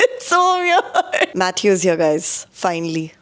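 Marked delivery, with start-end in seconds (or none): 0.1-1.4 slow, high, through giggles; awkward laugh while speaking. Real, not acted. Matthew arrives while she's filming.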